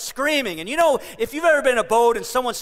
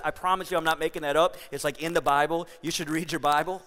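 ordinary talk

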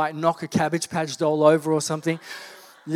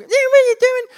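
much higher, louder sister voice; imitating sister; 10 dB louder than usual. Sister splashed at the sink, indignant in a high voice. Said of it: "she actually spoke like that".

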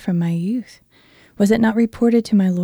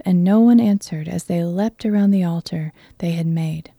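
plain reading voice

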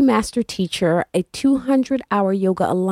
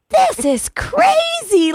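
much higher, slow disbelief; incredulous; slower than usual. Retold or imitated. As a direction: stretch it out high in disbelief. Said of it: "And I'm like".

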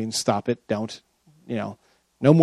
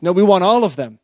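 a hint of a stubborn child; lightly whined demand; mock whiny insistence; 4 semitones higher, 5 dB louder than usual.

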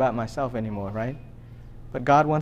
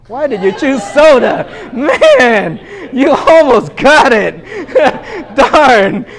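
higher, much louder, laughing through the words; laughing mock disappointment; 10 semitones higher, 13 dB louder, much slower than usual. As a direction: playfully lament the son's choice while cracking up. Teasing son over soda choice.

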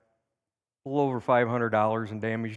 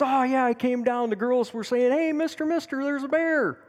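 high, louder mock of girls calling out; friend quoting the girls; 13 semitones higher, 4 dB louder than usual. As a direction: squeak the girls' "hey mister" alarm in fun. Friend retells girls calling him.

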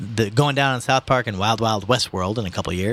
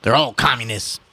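lightly voiced protesting old man; agitated old man; 5 semitones higher, 4 dB louder than usual. A narrator's reading. Old man warning the mother, agitated. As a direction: start raised and higher, press the accusation.

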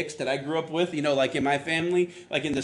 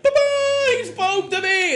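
very high, loud mock rapture; hammed-up breathless excitement; mock ecstatic; 6 dB louder than usual. Mocking believers expecting a sign, high mock ecstasy. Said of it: "big booming voice".

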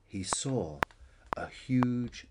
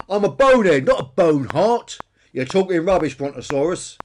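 loud, high, scornful dinosaur; indignant bonehead Peter; 6 semitones higher, 12 dB louder than usual. A narrator's reading. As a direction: protest loudly at the brontosaurus. Peter contradicts Billy.

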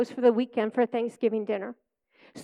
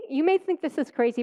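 ordinary delivery

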